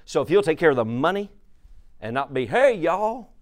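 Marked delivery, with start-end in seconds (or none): 2.5-3.3 high, put-on country hello; preacher mocking a careless church greeting; mock folksy greeting.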